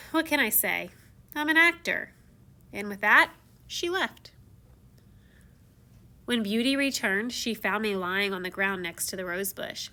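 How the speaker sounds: usual speaking voice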